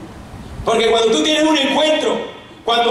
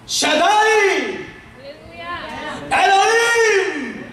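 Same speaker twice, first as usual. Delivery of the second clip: high, sustained wailing invocation; crying out; 8 semitones higher, much slower than usual. Acted. Woman trying to get the Lord's attention, crying out. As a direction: stretch each name into a high cry. Woman calls God by names.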